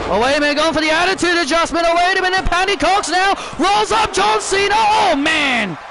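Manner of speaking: quickening commentator call, building up; excited wrestling commentary; play-by-play call. Acted. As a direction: call the match like a ringside announcer. Live play-by-play of the match.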